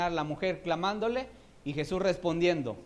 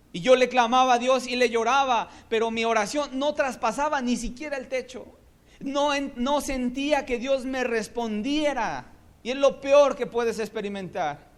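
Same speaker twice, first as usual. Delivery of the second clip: raised, loud preaching voice, pushing hard; fiery preaching; 8 semitones higher, 7 dB louder than usual. Acted. Preacher telling the church about silent heaven, in fiery preaching. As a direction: preach it out at full force to the congregation. Preacher recounting unanswered prayer.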